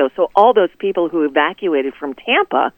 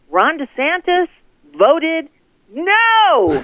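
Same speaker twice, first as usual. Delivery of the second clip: high, suspenseful build to a drawn-out "no"; big emphatic no; 9 semitones higher, much slower than usual. Real, not acted. Guest reveals governor's hurricane relief vote, drawn-out emphatic "no". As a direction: announce it piece by piece, stretching "no" up high. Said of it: "That would be a big N O".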